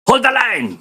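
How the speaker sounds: high commanding shout; officer's shouted command. Retold or imitated. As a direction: bark the order to the troops. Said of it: "sisigaw dapat dyan, yung opisyal".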